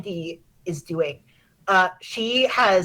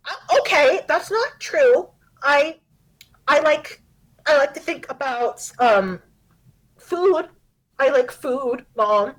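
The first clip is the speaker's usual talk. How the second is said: high, louder, stumbling to deflect; a kid nervously changing the subject; lightly voiced, fumbling teen; flustered daughter; 6 semitones higher, 4 dB louder than usual.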